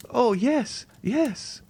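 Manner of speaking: put-on high, pleading young woman's voice; a terrified girl refusing to believe; frightened young girl. A frightened young Valentine begging the Count to leave her.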